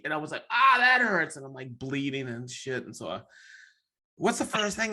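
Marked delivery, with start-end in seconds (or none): 0.5-1.4 high, loud yelp of pain; hammed-up high cry of pain; mock pain cry. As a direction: cry out as if just hurt. Retold pain.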